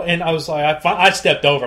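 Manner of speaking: normal talking voice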